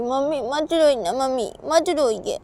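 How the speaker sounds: put-on high, pleading child voice; consoling little daughter. Child begging mummy not to cry, pleading. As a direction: coax someone in a loud child's plea.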